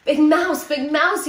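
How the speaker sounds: little mouse waking his snoring friend, loud call. A narrator's reading. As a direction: call out to wake him, loud. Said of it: "he called".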